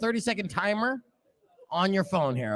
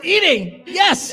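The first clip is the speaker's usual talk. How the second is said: excited; 9 semitones higher, 7 dB louder, much slower than usual. Real, not acted. Host cheers right answer.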